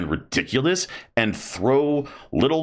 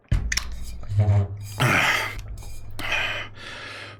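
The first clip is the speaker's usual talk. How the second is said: long sigh; 3 dB quieter than usual. Real, not acted. Sighing before new topic.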